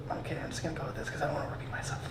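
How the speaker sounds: whispered fast aside to himself; under breath. Retold or imitated. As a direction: mutter it quickly under the breath. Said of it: "and I was like".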